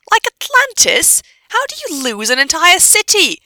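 high, loud, outraged questioning; incredulous. Acted. Hermione asking how a city gets lost, incredulous. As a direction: echo it back in loud disbelief.

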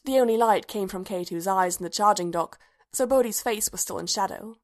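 ordinary delivery, nothing marked

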